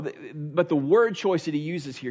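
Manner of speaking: ordinary delivery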